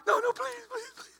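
high, whiny mock begging; Ric Flair begging. Preacher mimicking a wrestler begging for mercy, high whine. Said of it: "he'd be like".

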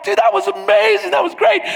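high, lively praise; cheering on. Retold or imitated. Friends hyping up the skier after his crash, lively cheer. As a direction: hype up a buddy.

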